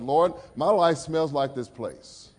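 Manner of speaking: slow, pleading prayer; a hint of remembered repentance; retold earnest prayer. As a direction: confess it slowly to God.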